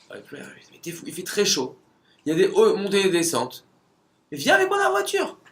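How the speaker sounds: the Texan driver insists the runner get in, in a loud high mock holler; insistent Texan. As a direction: holler over the engine to talk him into the car.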